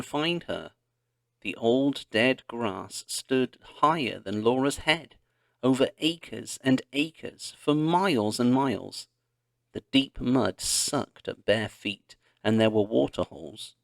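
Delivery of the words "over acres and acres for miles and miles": ordinary delivery, nothing marked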